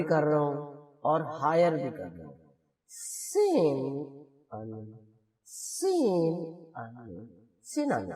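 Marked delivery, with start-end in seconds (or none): 2.9-8.1 سین and ان given equal slow length; even-timed syllables. Shown as a demonstration. Teacher showing students equal timing on سین ان. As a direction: hold سین ان slowly, each syllable the same time. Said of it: "جتنا time میں ایک syllable".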